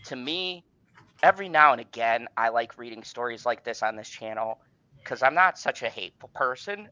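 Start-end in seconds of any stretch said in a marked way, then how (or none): none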